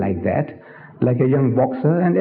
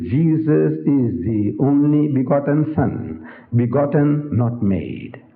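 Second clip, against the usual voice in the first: slow creed recitation; slower than usual. Retold or imitated. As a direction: recite the creed slowly, letting the last words sink. Quoting their books again.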